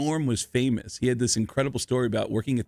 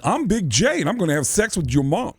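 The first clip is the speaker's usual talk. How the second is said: higher put-on voice, mildly hollered; Big J catchphrase; 5 semitones higher than usual. Shown as a demonstration. Host obliging friends who ask him to yell it, put-on holler. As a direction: trot out the signature boast on demand. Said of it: "Can you yell it".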